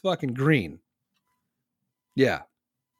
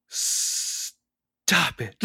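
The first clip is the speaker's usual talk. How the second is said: gasped, drawn-out breathy rebuke; hammed-up scandalized whisper; mock-scandalized; 2 semitones higher, 3 dB quieter than usual. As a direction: hold the 's' in exaggerated shock. Scandalized crowd, acted again.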